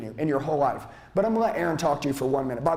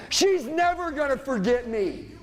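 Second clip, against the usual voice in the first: preaching at full force; 11 semitones higher, slower than usual. Acted. Speaker firing up seminar crowd against haters, preaching at full force. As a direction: preach it like a fired-up revival.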